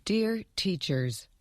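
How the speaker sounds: ordinary delivery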